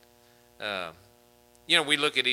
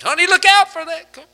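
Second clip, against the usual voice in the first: high, loud panicked warning; alarmed wife; 12 dB louder than usual. Retold or imitated. Wife warning her husband about a car, high and loud. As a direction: shriek the warning from the passenger seat. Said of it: "My wife does me this way".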